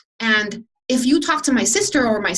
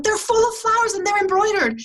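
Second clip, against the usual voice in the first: much higher, dreamy enchanted voice; a stranger spellbound by embroidered boots, high voice; 11 semitones higher than usual. Retold or imitated. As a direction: sound spellbound by the flowers. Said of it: "she was, like, so enchanted".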